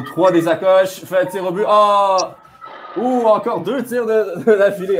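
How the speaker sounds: high-pitched, thrilled reaction to shots; rising excitement as the shots come; excited sports commentary. Real, not acted. Shots on goal in live commentary. (commentaire sportif excité)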